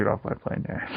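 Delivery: quiet unvoiced delivery; whispered movie quote. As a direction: murmur the famous line. Harrison Ford quote whispered.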